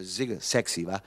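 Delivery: ordinary delivery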